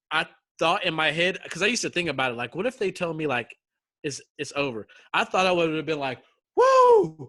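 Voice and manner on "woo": loud, high drawn-out "woo"; celebratory whoop. Retold or imitated. Imagining being told it's over, celebratory whoop. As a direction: let out a big whoop of relief.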